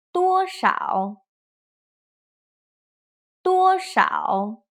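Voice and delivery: "多少" with high level "多", dipping "少"; first plus third tone. Shown as a demonstration. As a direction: hold "多" high, then dip and lift "少". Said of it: "first tone plus third tone".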